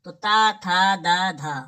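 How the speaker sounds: ता, था, दा, धा each sounded out clearly; teacher showing the dental letters; reciting the ta row. Shown as a demonstration. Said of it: "ये देखिए".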